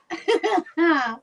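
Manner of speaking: laughing. Real, not acted. Laughing at misread words.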